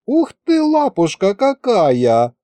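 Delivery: high, drawn-out cooing; adoring coo. A narrator's reading. Masha seeing the furry ball, adoring coo. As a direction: coo over something cute. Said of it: "умилилась Маша".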